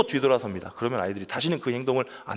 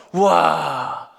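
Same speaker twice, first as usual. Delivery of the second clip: loud, drawn-out pretend wow; mock amazed cheer; 3 semitones higher, 8 dB louder, much slower than usual. Preacher imagines new families packing church, mock amazed cheer. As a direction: cheer as if wildly impressed.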